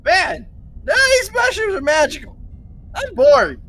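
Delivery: bored whine. Acted. Bored complaint as character.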